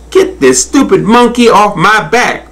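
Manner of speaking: high, indignant crocodile outburst; roared complaint. A narrator's reading. Crocodile with monkey dancing on her, angry outburst. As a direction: demand it indignantly, pitch way up. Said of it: "roared Cordelia".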